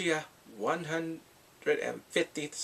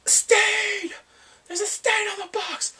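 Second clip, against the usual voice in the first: very high, loud customer's outcry; outraged mock shout; 8 dB louder, slower than usual. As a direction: shriek it like an appalled customer. Acts out outraged customer spotting stain.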